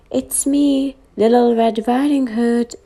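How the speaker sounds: put-on wolf faking a high little girl voice; sweet, high, pretend little girl. A narrator's reading. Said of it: "he said in a voice like a little girl's".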